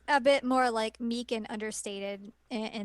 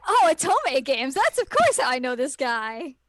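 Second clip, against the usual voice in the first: excited recognition; 3 semitones higher, 8 dB louder than usual. Retold or imitated. Gamer quoting herself spotting the actor, excited. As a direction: squeal it like spotting a familiar face.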